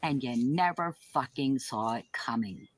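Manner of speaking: ordinary talk, nothing marked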